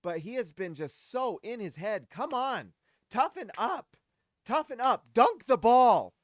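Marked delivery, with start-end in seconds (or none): 2.1-5.1 rising yells, last one slow and loud; frustrated shouting. Real, not acted. Fan yelling at Walker Kessler to toughen up, exasperated shout. 5.1-6.1 loud, slow shout; loud slow command. Real, not acted. Fan shouting at Kessler, slow loud order. First yell.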